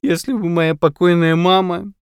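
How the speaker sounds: high, loud wailing lament; tearful. A narrator's reading. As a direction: wail it as if through sobs. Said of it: "сквозь рыдания".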